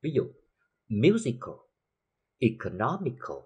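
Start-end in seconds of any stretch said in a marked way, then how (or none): 0.9-1.5 "musical" punched on the first syllable, MU; stress on MU. Shown as a demonstration. Teacher giving a stress example, MU stressed in "musical". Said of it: "cách nó một vần về phía trước". 2.4-3.4 "economical" stressed on the third syllable, NOM. Shown as a demonstration. Teacher illustrating the rule, NOM stressed in "economical". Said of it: "cách nó một vần về phía trước".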